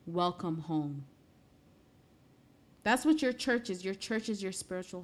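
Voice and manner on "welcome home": low, slow, loving welcome; tender welcome. Acted. Heavenly Father receiving the newly saved speaker, tender and slow. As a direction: draw it out gently, like a long embrace. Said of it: "deep hug from my heavenly Father saying".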